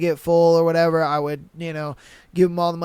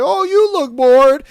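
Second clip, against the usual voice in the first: mock customer; 6 semitones higher, 7 dB louder than usual. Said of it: "they would just be like".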